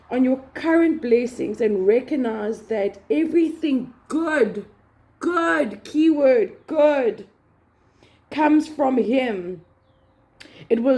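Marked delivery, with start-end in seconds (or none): 4.1-7.3 good, good, keyword, good, each word punched, higher and louder; stress on good. Shown as a demonstration. Urging listeners to count blessings, stressing good. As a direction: lean hard on every good. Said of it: "I want to emphasize on good".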